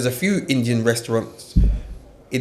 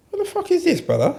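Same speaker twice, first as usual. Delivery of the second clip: shocked disbelief; faster than usual. Retold or imitated. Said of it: "I'm like".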